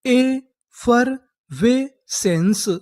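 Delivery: Effervescence stretched out syllable by syllable; very slow model word. Shown as a demonstration. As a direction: draw effervescence out very slowly. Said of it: "तो हमारी पूरी meaning क्या बनी?". (Effervescence बहुत धीरे, खींचकर)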